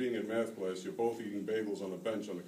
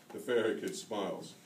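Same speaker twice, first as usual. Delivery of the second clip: ordinary delivery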